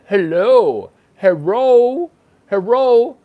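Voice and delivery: hammed-up hello with a put-on Chinese accent, L for R; mock Chinese accent.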